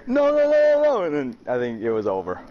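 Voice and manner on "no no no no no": mock panic. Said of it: "I just heard".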